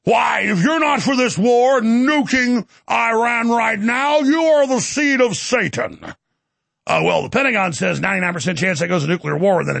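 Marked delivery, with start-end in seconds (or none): 0.0-6.0 slow, thundering mock pulpit denunciation; hammed-up, drawn-out preacher shout; affected preacher voice. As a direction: condemn like a fire-and-brimstone pastor. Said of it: "It would sound even more powerful".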